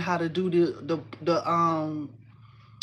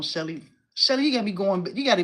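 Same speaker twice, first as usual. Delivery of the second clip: ordinary talk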